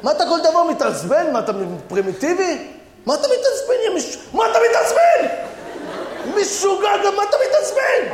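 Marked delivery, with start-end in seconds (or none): 3.1-8.1 yelling. Acted. Comic quarrel, yelling back.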